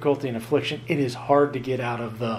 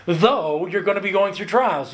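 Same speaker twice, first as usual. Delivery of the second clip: "Though" held long and pitched high; stress on THOUGH; 4 semitones higher than usual. Shown as a demonstration. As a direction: lean hard on "Though" before moving on. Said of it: "we could emphasize the previous word".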